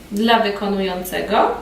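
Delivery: normal talking voice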